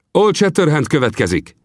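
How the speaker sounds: Vinetú sternly calling the Kiowa chief to order; firm, commanding chieftain; stern rebuke. Acted. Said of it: "ismételte Vinetú".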